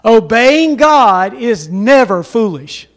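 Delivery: slow and loud, every word weighed; slow, loud repetition. Shown as a demonstration. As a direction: lead the congregation slowly, word by word. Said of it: "Would you repeat that with me?".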